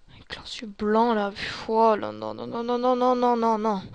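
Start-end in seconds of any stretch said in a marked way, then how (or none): 1.7-3.8 loud, run-together panicked protest; panicked refusal. Real, not acted. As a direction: protest loudly as the zombies close in. Zombies approaching, refusing. (refus paniqué, à voix forte)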